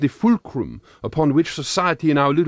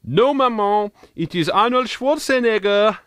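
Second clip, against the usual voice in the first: cheeky reply; 8 semitones higher than usual. Retold or imitated. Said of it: "I have taken to saying on occasions".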